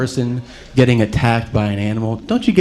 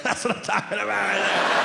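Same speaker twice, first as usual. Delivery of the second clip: put-on pleased toddler; 7 semitones higher, 7 dB quieter than usual. Toddler delighted by the yelling.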